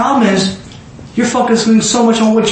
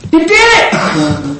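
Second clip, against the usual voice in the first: drawn-out acted cheer; celebrating; 3 semitones lower, much slower than usual. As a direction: stretch it out in amazed congratulation. Acting Jesus celebrating the man's faith.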